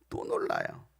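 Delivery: low, hushed deadpan repeat; quiet aside. Real, not acted. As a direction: drop right down after the exclamation. (낮고 작게 되뇌듯)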